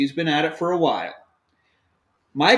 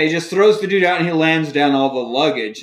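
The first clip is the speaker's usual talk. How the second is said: ordinary talk, nothing marked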